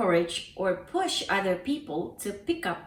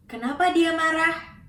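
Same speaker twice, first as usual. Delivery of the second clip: higher and louder, surprised; astonished question; 7 semitones higher, 4 dB louder than usual. Shown as a demonstration. Teacher shows surprise at an angry friend. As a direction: ask in disbelief. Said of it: "expression of astonishment".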